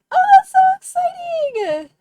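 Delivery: high, loud, drawn-out squeal; excited squeal. Real, not acted. Streamer thrilled at a friend's news, excited squeal. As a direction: squeal it out with real delight.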